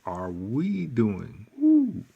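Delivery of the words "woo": whoop. Real, not acted. Excited teacher cheer.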